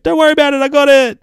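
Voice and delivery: very high, loud put-on voice; Gabby. Gabby calling to her sister as danger comes, high loud shout. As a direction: call it out high and confident. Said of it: "and Gabby's like".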